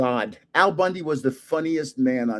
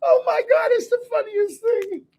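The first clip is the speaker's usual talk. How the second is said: laughing. Real, not acted. Laughing about the sitcom.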